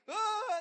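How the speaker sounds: high loud yelp of falling; mock scream of panic. Peter sinking in the waves, screaming. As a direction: shriek as you start to sink.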